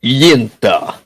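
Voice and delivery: Yinta in a Russian accent, YIN-tuh. Shown as a demonstration. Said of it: "if you do it with a Russian accent".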